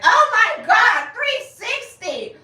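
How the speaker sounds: very loud, high, slowed frantic shouting; hyped fan screaming. Retold or imitated. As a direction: scream like a fan losing it over a spin. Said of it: "about to have a heart attack".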